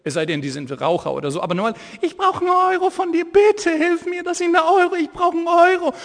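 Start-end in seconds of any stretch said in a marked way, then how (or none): 2.0-5.9 much higher squeaky pleading beggar. Retold or imitated. Preacher imitating a blind beggar asking for a euro, squeaky and high. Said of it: "mit einer ganz piepsigen Stimme".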